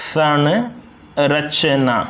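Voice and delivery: संरचना drawn out very slowly as "sanrachna"; teacher slowly voicing संरचना; slow demo pronunciation. Shown as a demonstration. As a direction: sound out संरचना with a clear न. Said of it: "न का उच्चारण होता है".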